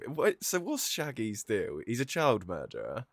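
ordinary delivery

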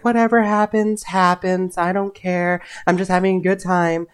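high, airy don't-care voice; breezy carefree. Retold or imitated. Imitating his carefree self before the job call, airy and high. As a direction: float it like nothing matters.

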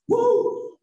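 loud high-pitched "woo!"; whooping. Real, not acted. Speaker teasing the crowd about its enthusiasm, high loud whoop. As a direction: let out a big high whoop. Mock cheer at quiet audience.

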